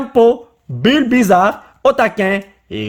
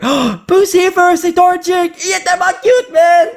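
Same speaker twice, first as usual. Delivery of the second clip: excited gasp; 10 semitones higher than usual. Real, not acted. Gasps at favourite card.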